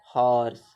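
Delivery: हॉर्स said alone, low, with its H sounded; sounded-out word. Shown as a demonstration. Teacher showing students how horse is written, sounded-out हॉर्स with audible H. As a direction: say हॉर्स by itself with an audible H at the start. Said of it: "हॉर्स कैसे लिखते हैं".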